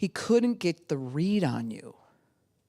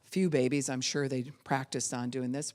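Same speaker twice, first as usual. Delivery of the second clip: ordinary talk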